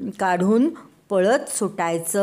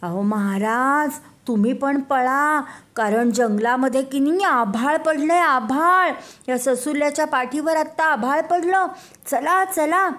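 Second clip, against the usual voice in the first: frantic chick to king; 7 semitones higher than usual. Acted. Chick urging the lion king to flee, high panic. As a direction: beg the king to run too. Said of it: "कोंबडीचं पिल्लू म्हणालो".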